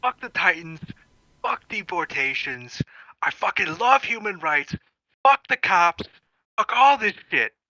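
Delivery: crude rallying speech. Acted. Dim leader attempting a speech to the crowd, crude rallying cries. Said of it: "and then he just goes".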